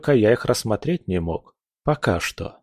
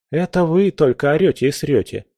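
ordinary delivery, nothing marked

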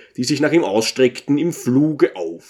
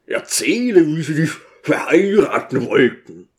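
1 pleading; 3 semitones higher than usual. A narrator's reading.

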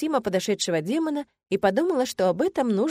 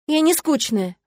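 indignant; 7 semitones higher, 4 dB louder, slower than usual. A narrator's reading. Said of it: "возмутилась".

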